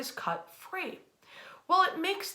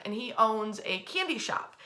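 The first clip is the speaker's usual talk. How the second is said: ordinary talk, nothing marked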